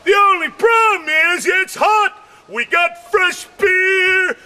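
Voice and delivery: ordinary talk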